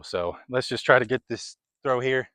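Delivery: normal talking voice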